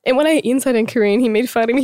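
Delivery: usual speaking voice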